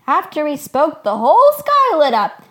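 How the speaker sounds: high, loud, amazed shepherd; excited interruption. A narrator's reading. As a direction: burst in loudly with the marvel. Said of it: "Blue Shell interrupted".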